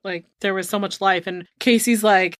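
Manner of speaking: ordinary talk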